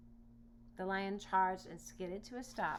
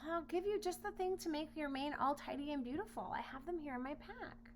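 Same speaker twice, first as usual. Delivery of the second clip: higher, brighter offer; lightly voiced eager boy; 8 semitones higher than usual. Boy offering his comb to the lion, higher.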